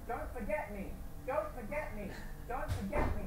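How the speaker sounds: high repetitive battle-cry imitation; imitated game NPC. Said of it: "it's like that".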